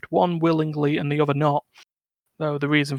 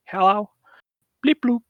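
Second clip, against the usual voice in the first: Caboose voice; 5 semitones higher, 6 dB louder than usual. Retold or imitated. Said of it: "the ghost of Caboose is saying".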